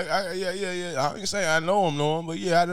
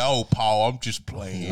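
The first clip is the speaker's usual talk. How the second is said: low mock daddy voice; 9 semitones lower, slower than usual. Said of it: "he said".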